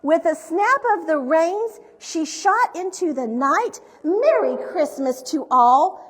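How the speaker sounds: projected, rhyming picture-book narration; story reading to children. A narrator's reading. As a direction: read the rhymes out with big, deliberate emphasis.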